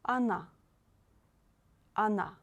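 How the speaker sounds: Она said "a-NA", opening on "ah". Shown as a demonstration. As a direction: model Она with the unstressed O as A. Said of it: "O you read as A".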